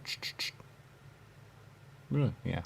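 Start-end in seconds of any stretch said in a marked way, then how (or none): 0.1-0.5 radio static. Retold or imitated.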